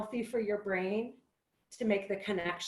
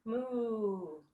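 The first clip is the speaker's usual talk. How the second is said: cow moo; 4 dB quieter than usual. Shown as a demonstration. Yoga teacher to kids in cow pose, soft moo. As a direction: moo gently like a calm cow. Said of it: "Cow pose".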